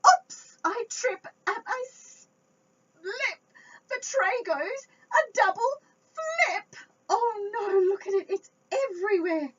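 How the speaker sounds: high, loud hammed-up panic; mock dismay. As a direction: wail over the spilled tray. Tray falls, mock dismay.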